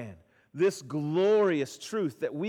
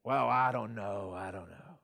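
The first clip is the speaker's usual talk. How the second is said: mock kid reluctance; 10 semitones lower, 5 dB quieter than usual. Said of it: "And I get feedback".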